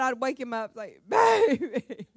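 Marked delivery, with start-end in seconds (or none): 1.1-2.1 high, loud, slow wailing plea, laughing through it; mock crying plea. Wife waking husband, begging him not to leave, mock sobbing. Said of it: "I would just start crying".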